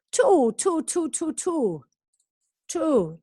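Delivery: "Two" repeated high with a long oo; drilling the oo sound. Shown as a demonstration. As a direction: stretch the oo in "Two". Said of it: "teacher modelling vowel sound".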